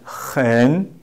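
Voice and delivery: "hen" drawn out, dipping then rising; model pronunciation. Shown as a demonstration. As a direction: model a slow "hen" with rough h. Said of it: "we pronounce as".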